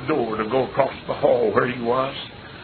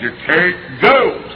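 firm refusal; 4 semitones higher, 6 dB louder, much slower than usual. Retold or imitated. Father forbids son, firm.

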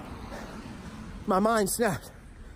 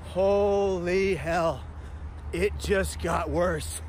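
dramatic slow aside; much slower than usual. Acted. Date disaster, dramatic aside.

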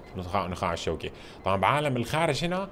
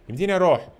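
أروح called out louder and higher on the repeat; excited repeat; 7 semitones higher, 6 dB louder than usual. Real, not acted. Gamer to viewers, asking again, louder and eager.

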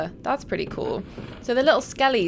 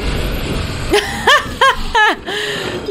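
loud high cackle; laughing at a staggering enemy; high burst of laughter; 11 semitones higher, 6 dB louder than usual. Real, not acted. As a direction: laugh out hard. Laughs after hitting enemy.

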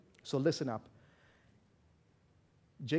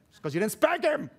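loud, higher mock scolding; joking retort; 7 semitones higher, 5 dB louder than usual. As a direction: fire back in jest. Joking retort to complaining parents.

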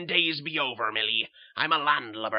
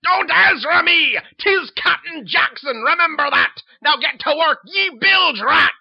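loud, harsh pirate-captain roar; bellowing captain; 12 semitones higher, 15 dB louder than usual. Acted. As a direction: bark orders like a tyrant at sea. Mad captain berating sister.

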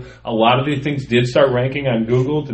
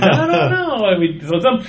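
put-on girlfriend's reply; 9 semitones higher than usual. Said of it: "and I'd say".

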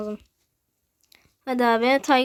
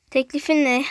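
usual speaking voice

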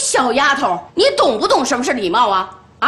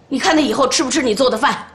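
ordinary talk, nothing marked

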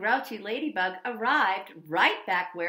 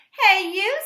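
loud, high challenge; grouchy ladybug; 9 semitones higher, 12 dB louder than usual. Acted. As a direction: shout a bold dare. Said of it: "said the grouchy ladybug".

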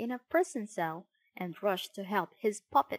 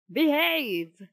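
loud, drawn-out order; raised-voice telling-off; scolding; 4 semitones higher, 7 dB louder than usual. A narrator's reading. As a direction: scold a naughty child firmly. Geppetto scolds the unruly puppet.

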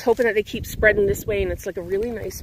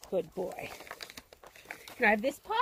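much softer, gentle praise; 10 dB quieter than usual. Real, not acted. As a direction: murmur praise to the dog. Praising dog softly.

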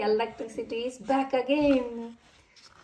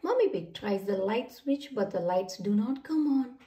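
plain reading voice

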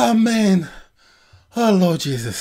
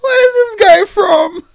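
higher, laugh-crying question; 7 semitones higher than usual. Real, not acted. Viewer asking about the man, laughing. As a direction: ask it while still laughing.

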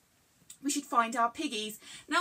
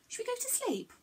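softer, higher, gentle question; sleepy hush; 10 semitones higher, 6 dB quieter, faster than usual. Pretend. Presenter coaxing little viewers to sleep, soft and gentle. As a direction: coax them toward sleep.

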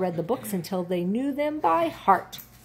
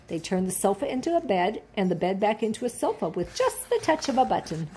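usual speaking voice

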